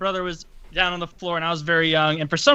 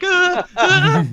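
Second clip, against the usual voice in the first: imitating a whimper; 12 semitones higher, 5 dB louder than usual. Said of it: "I just sat there weeping".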